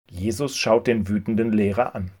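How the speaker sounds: plain reading voice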